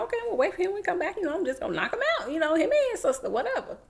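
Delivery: imitating his own sixteen-year-old swagger, fast and high; cocky inner voice. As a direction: rattle it off like a kid who thinks he can't lose. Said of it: "I'm like".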